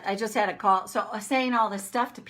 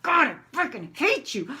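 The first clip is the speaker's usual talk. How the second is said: venting anger; 4 semitones higher than usual. Retold or imitated. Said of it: "like that".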